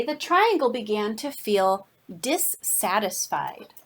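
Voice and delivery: ordinary delivery, nothing marked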